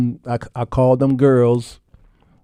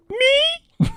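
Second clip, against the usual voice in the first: put-on squeaky, much higher little-boy voice; be a shy kid who can't believe it; childlike disbelief. Husband as a little boy doubting his wife's praise, squeaky and high.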